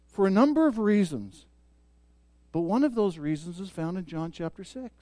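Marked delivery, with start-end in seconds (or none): 2.5-4.9 much softer, intimate and close; hushed sermon aside. Real, not acted. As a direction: drop to a near hush to draw them in. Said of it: "intimate soft point about safety".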